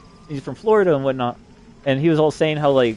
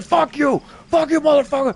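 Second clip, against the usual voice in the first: high, raised-voice retold insult; acted angry cursing; 13 semitones higher, 4 dB louder than usual. The voices cursing at him, acted high-pitched anger.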